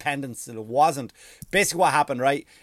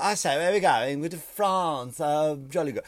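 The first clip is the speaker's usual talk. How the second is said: mock English toff. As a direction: put on a caricature English gentleman. Acting out the English rowing over.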